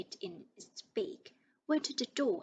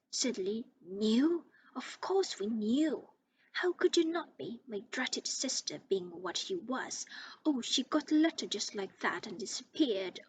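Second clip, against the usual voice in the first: ranting. Acted. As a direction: pour out years of resentment. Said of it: "went ranting on".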